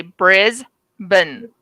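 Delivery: "Brisbane" slowly, in two parts: "BRIZ ... bun"; taught pronunciation. Shown as a demonstration. Teaching a friend the word, "Brisbane" slowly as "BRIZ-bun". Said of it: "I'll teach you how to say the word Brisbane".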